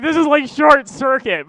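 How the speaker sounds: talking through a numb swollen mouth; words mushed by a swollen lip. Retold or imitated. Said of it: "I can't really talk, so it comes out".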